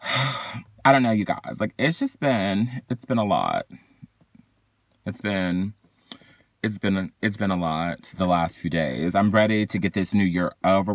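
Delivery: tired, trailing, heavy-hearted; weary. Real, not acted. Admitting it's been a lot.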